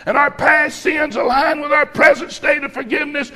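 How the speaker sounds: loud, drawn-out sermon cadence; full-force preaching. Acted. Preacher driving his point home to the congregation, loud and slow. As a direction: preach it hard to the church, every word stretched.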